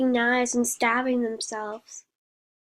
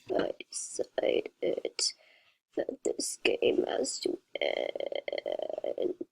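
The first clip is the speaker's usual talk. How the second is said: whispering narration; 7 dB quieter than usual. A narrator's reading. Hushed narration.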